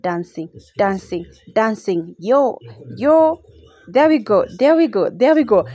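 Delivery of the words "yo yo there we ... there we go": excited cheering. Real, not acted. Dancing to the beat at dinner.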